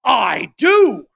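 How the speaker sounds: an emphatic, defiant "I do". Retold or imitated. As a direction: plant your feet and insist. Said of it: "Jonah's response is an emphatic".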